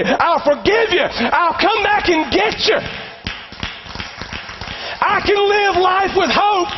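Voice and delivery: impassioned preacher crescendo; full-force preaching. Real, not acted. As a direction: build the promise to a passionate peak.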